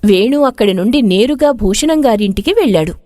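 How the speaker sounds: usual speaking voice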